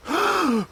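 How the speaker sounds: a sudden high gasp of alarm; quick shocked intake of breath; sharp gasp. Acted. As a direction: gasp as if Panda might fall. Panda high without safety net.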